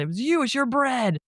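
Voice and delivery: high, mock accusing yell; imitated yelling. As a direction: act out a frantic accusation, no real volume. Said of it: "and he's yelling".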